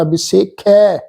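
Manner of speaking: ਵਿਸੇਖੈ ending in a full ਖੈ; careful pronunciation. Shown as a demonstration. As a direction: sound the ਖੈ round and full. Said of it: "ਖੈ ਖੱਖੇ ਤੇ ਦੁਲਾਵਾਂ ਦਾ ਪਾਠ ਆ".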